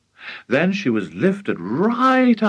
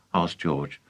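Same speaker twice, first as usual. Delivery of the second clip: ordinary delivery, nothing marked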